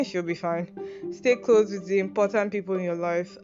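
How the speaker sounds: ordinary talk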